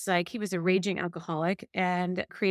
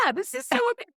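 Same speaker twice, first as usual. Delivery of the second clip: giddy gush; faster than usual. Retold or imitated. Inner self gushing mid-meditation.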